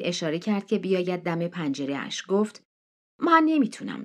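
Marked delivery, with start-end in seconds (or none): 3.2-4.0 high, strained plea; pleading refusal. Acted. Jinny pleading with Neil at the car window, high and strained. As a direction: beg off in one breath.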